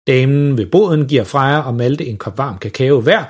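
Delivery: plain reading voice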